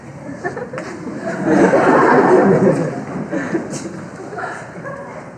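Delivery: mocking laugh.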